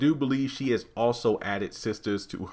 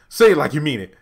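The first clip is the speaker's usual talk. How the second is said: louder, commanding; stern demand; 5 semitones higher, 9 dB louder, faster than usual. Retold or imitated. Veronica demanding a real apology, commanding. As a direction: order him to mean it.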